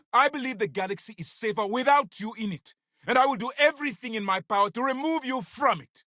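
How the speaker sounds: very high, heated tirade; furious denunciation. Acted. Officer vowing to remove the agent, furious denunciation.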